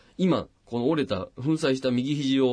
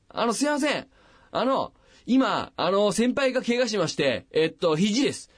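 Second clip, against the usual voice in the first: higher-pitched, flustered mock phone call; clueless junior on phone; 8 semitones higher than usual. Hapless junior phoning hospitals about his senior's elbow, high flustered imitation. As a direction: fumble politely through the call, pitched up. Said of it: "そいつがまたバカなのよ".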